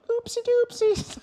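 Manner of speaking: put-on very high, slow, loud baby voice; Teletubbies. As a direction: squeak it slowly in a silly toddler-show voice. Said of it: "the Teletubbies used to say that".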